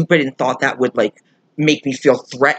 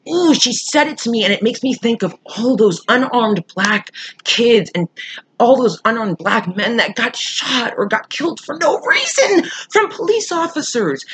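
worked up and indignant, pitched high; upset; 7 semitones higher than usual. Real, not acted. Venting to viewers about unarmed people getting killed, upset. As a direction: voice climbing high in outrage. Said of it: "that gets me so bad".

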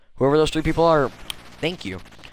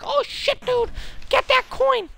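mocking viewer. Mocking viewers who want the coin grabbed, high excited voice. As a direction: parody a pushy chat viewer. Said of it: "some people will be like".

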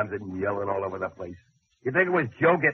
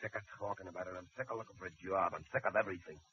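much softer, drained and beaten; weary defeat; 4 semitones lower, 12 dB quieter, faster than usual. Acted. Jobless husband giving up on everything, soft and weary. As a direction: let it all drain out of you.

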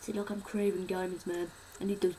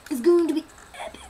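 fast, loud, higher hype; excited; 7 semitones higher, 11 dB louder, faster than usual. Real, not acted. Excited about new house spot.

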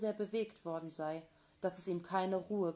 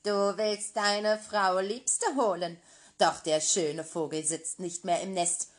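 scornful witch; 6 dB louder than usual. Acted. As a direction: taunt the prince cruelly. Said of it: "rief sie höhnisch".

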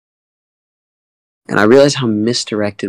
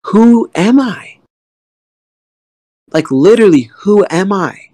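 anguished questioning; 9 semitones higher, 4 dB louder than usual. Real, not acted. Questioning himself, emotional climax.

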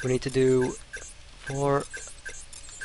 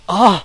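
loud, high cry of frustration; angry yelp; 6 semitones higher, 10 dB louder than usual. Real, not acted. Gamer failing and getting angry, frustrated yelp. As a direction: burst out at another failure. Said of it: "I'm getting angry now".

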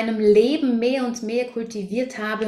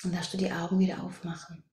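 meditation whisper; 3 semitones lower, 7 dB quieter than usual. Acted. Guide leading the group out of meditation, whispering softly. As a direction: whisper gently as the meditation closes.

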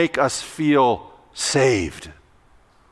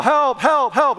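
higher, repeated calls for help; pretend yell for help; 7 semitones higher, slower than usual. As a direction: keep calling for help, pitched up. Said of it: "continue to yell".

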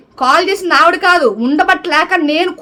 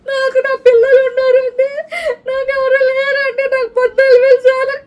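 mocking her crying; 9 semitones higher than usual. Vlogger mimics rival crying about her salary, mock sobbing whine. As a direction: parody a tearful woman pleading for sympathy. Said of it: "ఏడ్చుకుంటా".

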